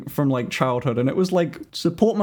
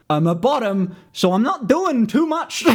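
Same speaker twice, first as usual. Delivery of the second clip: embarrassed blurt; 6 semitones higher than usual. Real, not acted. Awkward confession, shouts.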